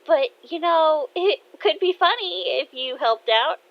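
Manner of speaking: high, loud daughter voice; teasing. Acted. Daughter teasing dad about math help, teasing.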